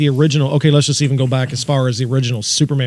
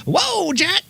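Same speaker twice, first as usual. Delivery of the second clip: surprised yelp. Retold or imitated. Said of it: "He's like".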